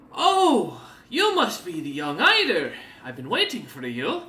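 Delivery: high, loud, grand greeting; a theatrical showman welcoming the hero; cat-eared Snowbeard. Acted. The cat-eared demon greets young Eider grandly, loud and high.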